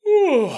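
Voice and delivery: high, loud waking stretch sound; stretching groan. Pretend. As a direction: stretch out with a big morning ooh.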